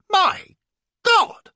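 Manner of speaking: shocked high cry. A narrator's reading. Flashman crying out at the discovery, shocked loud cry. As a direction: cry out in horror. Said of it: "cries I".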